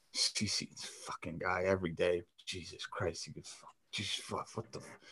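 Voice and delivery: muttered cursing under the breath, nearly whispered; very soft, breathy grumble of curses. Retold or imitated. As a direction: imitate a fuming teammate swearing quietly to himself. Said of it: "like, high strung".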